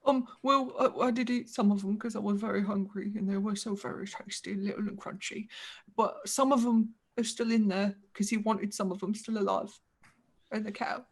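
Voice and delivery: softly voiced simple ogre; sheepish ogre. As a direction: confess quietly, like a guilty ogre child. Ogre confesses to eating people.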